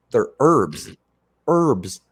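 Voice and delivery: "herbs" said with a hard h, loud; "herbs" with the h spoken, teasing; pronounced herbs with an h. Retold or imitated. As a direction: sound the h in "herbs". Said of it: "And I was like".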